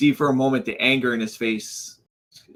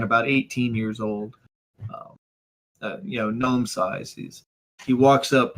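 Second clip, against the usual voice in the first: normal talking voice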